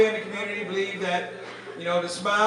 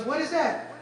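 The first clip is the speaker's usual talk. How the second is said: high curious voice; 7 semitones higher than usual. Retold or imitated. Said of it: "She goes".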